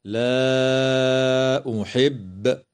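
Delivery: لا أحب with the alif of لا stretched long before the hamza; very slow chanted لا أحب; separated madd. Shown as a demonstration. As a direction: draw out لا for several beats, then أحب. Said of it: "من قبيل المد المنفصل".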